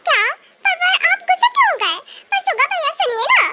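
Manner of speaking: put-on high girlish voice, whiny and pleading; a little girl begging him to wait; pleading young girl. Pleading girl begging [name] not to leave, whiny.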